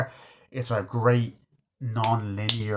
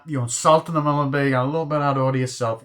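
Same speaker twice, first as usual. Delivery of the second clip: fast, raised mock telling-off; retold quick scolding; 4 semitones higher, 5 dB louder, faster than usual. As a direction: rattle off the rebuke, higher and quick. Acted De Niro scolding in quarrel.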